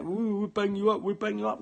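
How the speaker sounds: taunting voice of the people threatening him; mock threat. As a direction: mimic them jeering "we'll bang you up". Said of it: "these people wanna fucking try and keep threatening me".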